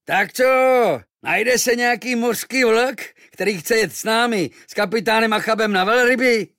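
high, loud sailors' recruiting cry; loud calling out. A narrator's reading. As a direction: holler across the harbour for volunteers. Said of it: "hlasitě pokřikovali". (hlasité pokřikování námořníků)

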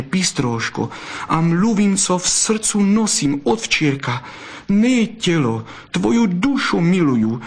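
high, urgent, fervent fox; impassioned wooing. A narrator's reading. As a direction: woo her in an ardent outpouring. Fox's impassioned declaration of love. (vášnivé vyznání vysokým hlasem)

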